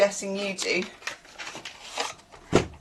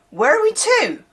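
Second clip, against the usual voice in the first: lightly put-on Bristol accent, pitched high; Bristol saying; 12 semitones higher, 5 dB louder than usual. Shown as a demonstration. Vlogger quoting a saying picked up in Bristol, Bristol accent. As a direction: swoop up high in a Bristol lilt. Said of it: "One I've picked up at teaching in Bristol".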